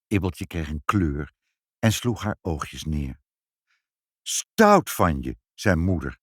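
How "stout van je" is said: slow, drawn-out, lower reproach; scolding. Acted. Mother scolding child for using the umbrella, reproachful. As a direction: stretch out the telling-off.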